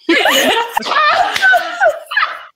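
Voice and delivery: very high giggling laugh; squealing laugh. Acted. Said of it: "And I have a special guest".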